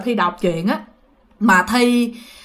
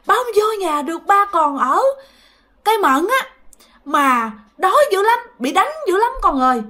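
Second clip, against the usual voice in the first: high, plaintive spirit voice; possessed grandfather; 9 semitones higher than usual. Retold or imitated. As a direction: imitate a hungry, beaten father lamenting. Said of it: "ông nói rằng". (giọng hồn ông than thở, cao)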